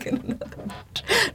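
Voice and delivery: breathless laugh. Real, not acted. Laughing whispered punchline.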